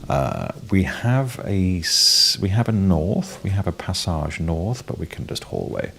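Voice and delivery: usual speaking voice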